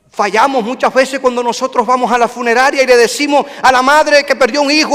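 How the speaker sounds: normal talking voice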